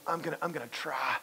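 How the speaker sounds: hesitant I'm gonna, I'm gonna try, trailing off; half-hearted promise. Shown as a demonstration. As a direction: stall and then give a weak promise. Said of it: "almost give that little bit".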